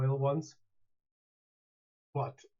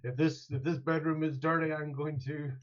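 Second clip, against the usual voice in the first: angry disappointed dad; 5 semitones higher than usual. Retold or imitated. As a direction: scold like a fed-up father. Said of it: "put on your angry, disappointed dad voice".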